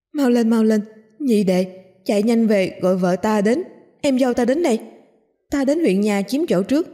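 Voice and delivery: eager, frantic instructions; urgent excitement. A narrator's reading. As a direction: send your brother off in a hurry. Townsman frantically urging brother to hurry.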